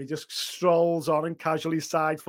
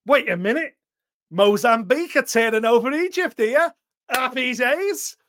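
excited; 6 semitones higher, 6 dB louder than usual. Retold or imitated. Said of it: "I'm like".

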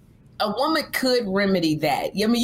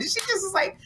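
usual speaking voice

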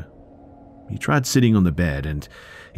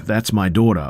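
mild narrated yell, higher and louder; screaming; 5 semitones higher, 5 dB louder than usual. A narrator's reading. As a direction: raise the voice as a protective father. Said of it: "He was also screaming".

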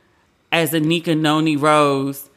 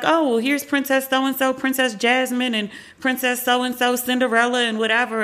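imitated park host; 7 semitones higher than usual. Park staff introducing the princesses to a visitor, imitated host voice. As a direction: present each name in a high, lilting mock-host voice.

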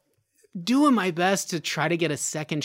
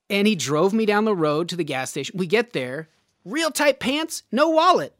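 ordinary talk

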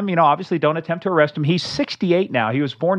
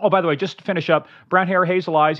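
ordinary delivery

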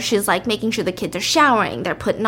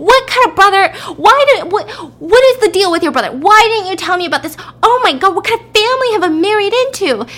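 hysterical wife; 10 semitones higher, 6 dB louder, faster than usual. Acted. Wife panics about husband's brother.

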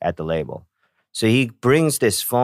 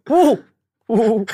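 very high, loud drawn-out whoas; awestruck whoa; 14 semitones higher, 4 dB louder than usual. Retold or imitated. Mithra handed the iPhone, high louder awestruck whoas. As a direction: squeal with wonder.

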